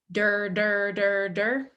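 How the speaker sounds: der with a light D into an R; der syllable. Shown as a demonstration. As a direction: chain der der smoothly. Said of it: "D R sound".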